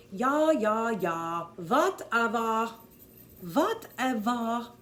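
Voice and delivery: loud, slow, hammed-up German accent; a bad German accent, loud and dismissive; terrible German accent. Shown as a demonstration. Coach shows the psychiatrist's line in a loud German accent. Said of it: "All W's are V's".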